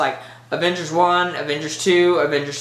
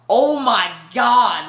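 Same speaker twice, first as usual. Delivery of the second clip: slow, stretched gasp of awe, pitched up; awed oh my God; 5 semitones higher, 4 dB louder, much slower than usual. Retold or imitated. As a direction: relive the moment of being blown away. Reacting to the Iron Man snap.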